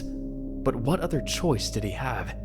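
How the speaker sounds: normal talking voice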